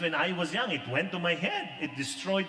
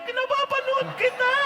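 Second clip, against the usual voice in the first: much higher and louder, thrilled; excited old woman; 7 dB louder than usual. Retold or imitated. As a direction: squeal it like a starstruck grandma.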